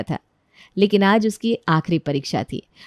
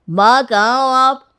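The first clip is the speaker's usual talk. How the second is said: slow, louder, higher call; 4 semitones higher, 6 dB louder, slower than usual. Acted. Son arriving home looks for his mother, calling out. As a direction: call for your mother across the house.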